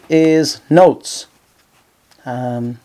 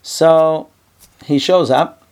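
ordinary talk, nothing marked

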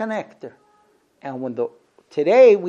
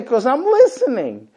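higher, insistent husband; mock husband; 8 semitones higher, 9 dB louder than usual. A husband answers his wife, insisting. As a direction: insist you are listening. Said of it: "You say".

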